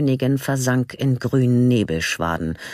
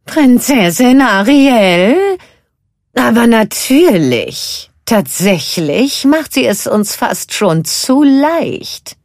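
high, loud, slow delighted cackle voice; gleeful witch; 11 semitones higher, 11 dB louder, slower than usual. A narrator's reading. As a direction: squeal with wicked glee. Sister excited about plan. (hohe, entzückte Hexenstimme)

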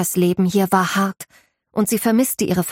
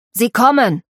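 put-on stable boy calls the arrival, louder and higher; calling out; 4 semitones higher, 5 dB louder than usual. Stable boy announces the guests, calling out. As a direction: shout the news through the house. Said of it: "rief Schorsch".

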